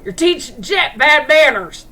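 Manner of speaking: loud, high, put-on scolding voice; scolding man. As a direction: tell the dog off sharply. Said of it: "you hear the man go".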